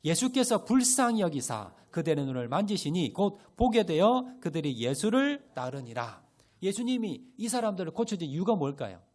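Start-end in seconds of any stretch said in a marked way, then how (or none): 0.0-6.3 louder, measured scripture reading; reading scripture together. Shown as a demonstration. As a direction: read the verse aloud with the congregation. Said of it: "다 같이 읽겠습니다".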